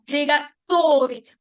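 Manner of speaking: slow приготовить, TO stretched and stressed; stress on second O. Shown as a demonstration. Teacher confirming where the accent stays, stressed TO in приготовить. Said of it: "sigue estando en la segunda O".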